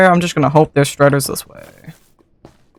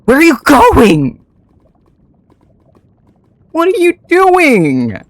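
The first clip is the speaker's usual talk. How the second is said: much higher, slow exasperated calling; high pleading calls; 11 semitones higher, slower than usual. Real, not acted. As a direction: plead with the runaway mount, high and drawn out. Pleading with a wandering Strider.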